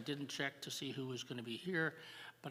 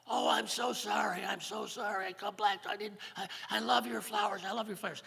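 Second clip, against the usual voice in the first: higher, louder mock-tearful pleading; hammed-up sorry husband; pleading apology; 7 semitones higher, 6 dB louder than usual. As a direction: beg her to come back, half crying. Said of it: "First man starts to cry".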